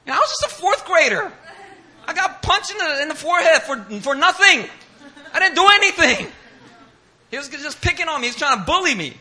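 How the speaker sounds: high, loud wailing protest; crying, acted. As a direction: mimic a bawling fourth grader. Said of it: "I just started crying".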